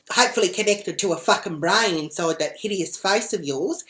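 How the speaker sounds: loud, high, indignant re-enacted rant; angry tirade. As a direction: let rip with a loud scolding. Teller ranting at woman in retold row.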